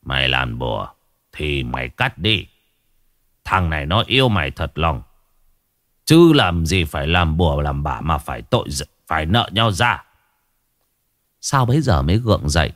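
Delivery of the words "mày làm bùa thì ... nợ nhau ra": put-on weary old shaman. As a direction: sigh out a scolding like a tired old man. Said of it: "ông thầy cúng mới thở dài".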